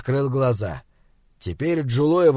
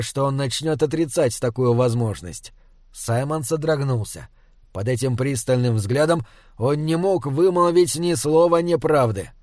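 plain reading voice

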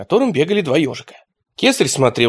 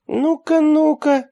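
much higher playful coaxing; teasing; slower than usual. A narrator's reading. A hint of Luka coaxing the lords. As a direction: lilt it up then down, curious. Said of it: "сказал Радищев".

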